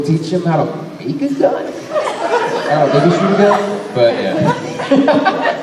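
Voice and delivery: laughing through the words; giggling while answering. Real, not acted. Laughing while joking about guns.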